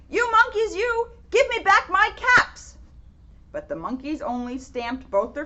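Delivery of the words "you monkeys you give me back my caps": higher, louder shouted demand; furious shout. Acted. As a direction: yell at them with both feet stamping. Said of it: "stamped both of his feet and shouted".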